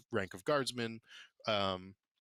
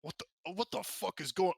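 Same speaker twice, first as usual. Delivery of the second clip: angry groundskeeper; 9 semitones higher, 4 dB louder than usual. Acted. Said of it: "and it goes".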